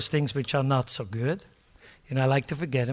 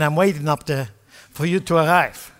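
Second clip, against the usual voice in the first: normal talking voice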